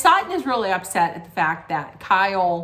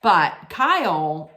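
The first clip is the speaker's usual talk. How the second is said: ordinary talk, nothing marked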